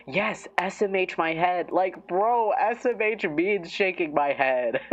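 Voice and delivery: high-pitched, amused disbelief; exasperated but joking, pitched well up; mock exasperation. Mocking a silly abbreviation to viewers, high joking exasperation. Mocks a redundant abbreviation as meme.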